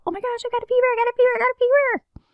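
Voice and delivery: very high, fast mock panic; panicked freak-out. Mocking how people panic over a fever, high frantic squeal. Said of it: "Most people freak out".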